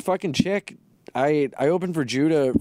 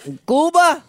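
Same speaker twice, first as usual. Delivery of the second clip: much higher and louder question; mock incredulous shout; 14 semitones higher, 8 dB louder than usual. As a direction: blurt it out in hammed-up disbelief.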